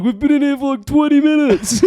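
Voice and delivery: high, loud, whining complaint; whiny trapped voice. Retold or imitated. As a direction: play the stuck, fed-up friends. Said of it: "they were like".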